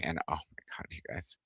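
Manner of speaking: a hushed, awed whisper to the viewers. Real, not acted. Telling viewers how good her pecan pie was, in an awed whisper. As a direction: lean in and breathe it like a secret.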